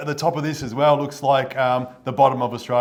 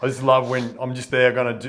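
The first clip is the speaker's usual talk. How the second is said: normal talking voice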